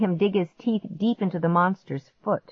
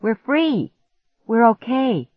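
excited; 6 semitones higher, 4 dB louder, slower than usual. Acted. Celebrating escape.

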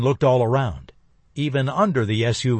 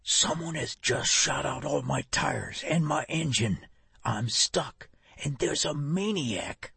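soft, hushed whisper; whispering on the phone; 2 semitones higher, 8 dB quieter than usual. Acted. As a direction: whisper it so no one hears. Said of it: "Laney whispered into the phone".

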